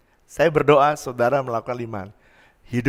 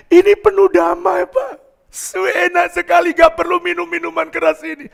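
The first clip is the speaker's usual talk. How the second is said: very high, loud exclaiming; imitated elation; 8 dB louder than usual. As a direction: act out the neighbour overjoyed at finding peace. Neighbour exclaims at the peace.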